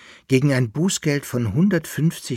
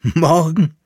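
high, loud incredulous cry; shrill exclamation; 7 semitones higher, 8 dB louder than usual. A narrator's reading. Bärlandfahrer hears the poodle's plan for tomorrow, shrill incredulous cry. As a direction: throw the word back, loud and high. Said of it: "rief der Bärlandfahrer mit einem schrillen Lachen".

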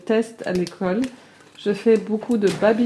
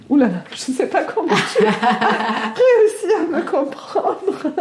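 fast, higher, laughing through words; 4 semitones higher than usual. Real, not acted. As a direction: laugh while getting the words out. Laughing at her old letter.

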